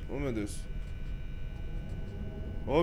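normal talking voice

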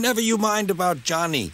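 high, amused character voice; lightly amused, without real laughter; amused driver. A narrator's reading. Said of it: "laughed the driver".

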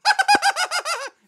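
loud, very high peal of laughter; high diva laugh. Acted. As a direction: cackle up high as the star guest. Impersonated star's showy laugh.

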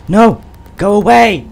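loud, high shouting no; frantic yell. Real, not acted. Player screaming at the monster to leave, frantic yell. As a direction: shout it away in panic.

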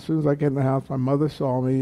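usual speaking voice

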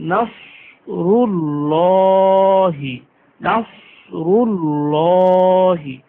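নাসরুল্লাহি with thick heavy s and full lam, drawn out; heavy sad. Shown as a demonstration. Teacher modelling heavy letters on নাসরুল্লাহি. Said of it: "স ... মোটা করে পড়তে হয়".

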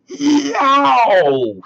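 sudden high loud yowl; wolf's yelp. A narrator's reading. Wolf falls into hot water, high loud pained yelp. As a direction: yelp as if scalded by boiling water. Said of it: "the wolf yelled".

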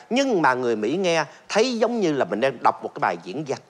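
ordinary talk

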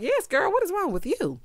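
high-pitched sassy challenge; sassy echo. Acted. Host echoing his question back, sassy attitude.

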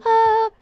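yüksek ve yüksek sesli kısa irkilme çığlığı; ürküp 'ha' diye bağır; korkuyla ani çığlık. Real, not acted. (korkan oyuncunun ani irkilme çığlığı)

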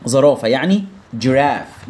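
normal talking voice

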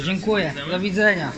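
ordinary delivery

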